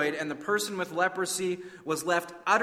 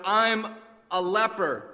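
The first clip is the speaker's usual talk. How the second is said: slow anguished cry, pitched up; cried out; 4 semitones higher, 4 dB louder, slower than usual. Acted. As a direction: let the confession break out of him. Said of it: "as he cried out".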